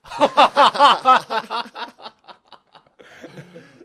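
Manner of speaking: high, loud cackling fit; loud burst of laughter. Real, not acted. Laughing at his own slip about dad, big loud laugh. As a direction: break up laughing hard.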